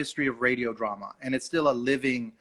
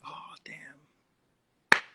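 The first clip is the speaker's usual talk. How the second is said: whispered oh damn. Retold or imitated. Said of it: "I'm like".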